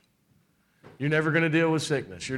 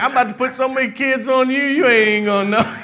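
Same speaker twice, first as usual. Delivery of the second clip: loud, higher, laughing through the words; laughing God voice; 7 semitones higher, 6 dB louder than usual. Retold or imitated. God teasing the preacher about future kids, laughing loudly. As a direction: tease her with a big laugh. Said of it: "He was like".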